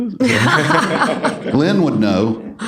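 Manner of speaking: laughing through the words. Real, not acted. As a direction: chuckle it out. Laughing while answering a question.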